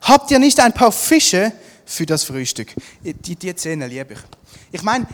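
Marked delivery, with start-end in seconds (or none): 0.0-2.8 loud, raised question; projected, higher-pitched hail; calling out. A narrator's reading. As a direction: call the question across to them. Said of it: "rief er ihnen zu".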